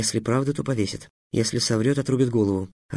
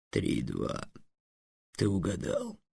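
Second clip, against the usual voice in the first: very low, soft, dry dragon voice; 4 semitones lower, 11 dB quieter, slower than usual. A narrator's reading. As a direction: concede the point flatly and low. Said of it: "сухо произнёс". (сухо, очень низким голосом дракона)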